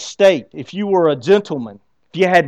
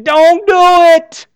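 high, loud, slow shout; pride screaming; 12 semitones higher, 9 dB louder, much slower than usual. Pretend. Inner pride shouting at the believer, loud high yell. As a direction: yell it out as your own pride. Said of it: "your pride is screaming".

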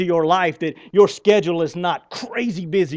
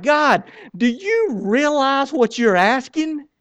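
incredulous plea; 7 semitones higher, slower than usual. Retold or imitated. Retelling his reply to God.

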